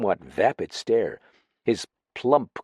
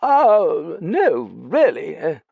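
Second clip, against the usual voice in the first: high, louder, drawn-out protest; flustered denial; 8 semitones higher, 6 dB louder, much slower than usual. Acted. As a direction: protest slowly in a high, embarrassed voice. Startled Dallings protests in embarrassment.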